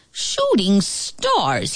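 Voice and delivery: put-on slow, curt old man snap; snapped. A narrator's reading. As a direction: bite off the words, slowly. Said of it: "he snapped".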